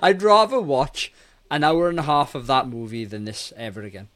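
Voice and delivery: ordinary talk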